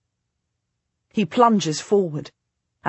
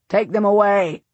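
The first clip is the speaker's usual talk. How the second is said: louder, clipped order to the guards; curt snap; 3 semitones higher, 6 dB louder than usual. A narrator's reading. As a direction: snap the command and be done. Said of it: "the Earl of Salisbury snaps".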